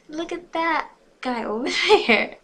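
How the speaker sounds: high, loud gush breaking into laughter; over-excited squeal with a real laugh; gushing, cracking up. A narrator's reading. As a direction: lose it laughing mid-line. Rereads gushing line, cracking up.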